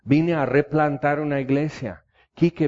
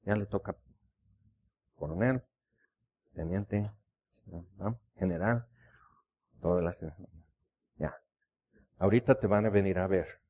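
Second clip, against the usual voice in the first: lower, softer, calm voice of God; 5 semitones lower, 5 dB quieter than usual. Retold or imitated. God quietly promising the preacher an answer, soft calm reassurance. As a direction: speak gently as God reassuring. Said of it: "Y Dios dice".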